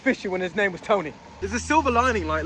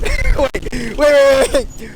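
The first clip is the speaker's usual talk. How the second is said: loud laughing call to stop; urgent, louder repeated wait; 8 semitones higher, 12 dB louder, much slower than usual. Real, not acted. Vlogger calling out to stop someone, loud and laughing.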